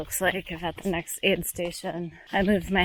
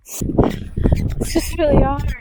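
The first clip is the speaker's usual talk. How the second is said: slow, higher and louder, breaking into tears; crying; 6 semitones higher, 5 dB louder, slower than usual. Real, not acted. Exhausted runner admitting the race is hard, crying. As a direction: let the exhaustion spill out as real crying. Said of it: "I'm just crying right now".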